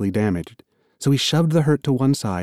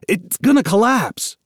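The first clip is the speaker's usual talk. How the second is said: higher urgent shout; shouted back; 9 semitones higher than usual. Acted. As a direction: yell it to scare them into opening. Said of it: "Amos shouted back".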